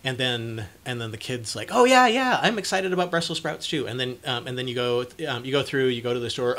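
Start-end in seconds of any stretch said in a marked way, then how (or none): 1.7-3.9 put-on high, loud eager kid voice; mock excited kid. Kid eagerly echoing parent's excitement, high and loud. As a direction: chirp it back like an eager kid copying mom.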